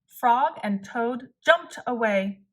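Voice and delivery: ordinary delivery, nothing marked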